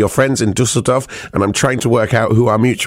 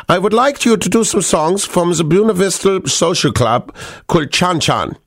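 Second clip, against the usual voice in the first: put-on higher, read out in a German accent; 6 semitones higher than usual. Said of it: "I'm allowed to do the German accent".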